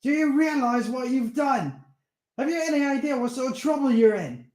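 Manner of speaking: high-pitched angry tirade. Acted. Steve scolding Darren about the vampire, angry. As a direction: berate him, incredulous.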